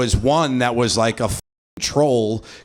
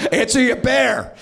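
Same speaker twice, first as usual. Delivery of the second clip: mock daddy on the phone; 9 semitones higher than usual. Comic plays dad calling his daughter's bear, higher sing-song coo.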